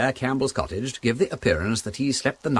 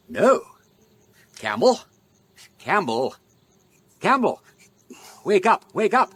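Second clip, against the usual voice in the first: mock alarm; 7 semitones higher, 3 dB louder than usual. Acted murderer tries waking corpse.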